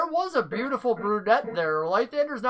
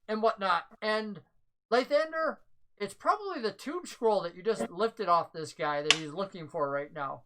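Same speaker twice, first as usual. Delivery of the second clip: usual speaking voice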